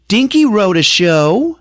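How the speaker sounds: high, loud, drawn-out proud announcement; proud stage wife. Retold or imitated. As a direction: crow it slowly in a high character voice. Opal announces show, pointing at husband.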